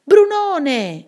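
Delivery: high, loud call falling away; storybook cry. A narrator's reading. A voice from the well calling Brunone, crying out. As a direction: call the name out from afar. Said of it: "gridò dal pozzo una voce".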